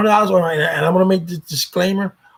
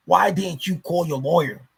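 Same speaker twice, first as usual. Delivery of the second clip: normal talking voice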